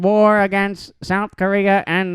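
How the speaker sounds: put-on slow, high old-timey newsreel announcer; 1950s announcer voice. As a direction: boom it out like a 1950s radio announcer. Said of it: "like, in a nineteen fifties, like, announcer voice".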